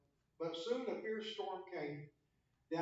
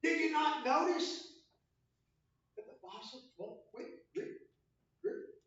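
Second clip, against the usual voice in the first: whiny complaint; 8 semitones higher, 5 dB louder than usual. Pretend. Mock complaint to Jesus.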